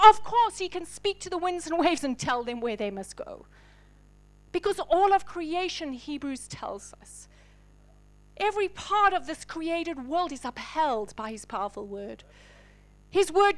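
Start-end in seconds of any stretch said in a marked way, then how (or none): 5.9-7.3 whispered, slow aside; hushed aside. Real, not acted. Preacher to congregation, a whispered aside. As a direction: lean in and whisper it.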